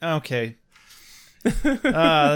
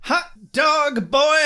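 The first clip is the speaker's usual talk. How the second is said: vintage cartoon voice; 9 semitones higher, 3 dB louder, slower than usual. Exclaims at long list.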